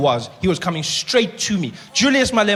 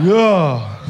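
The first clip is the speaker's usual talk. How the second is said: lightly voiced Malema; 9 dB louder than usual.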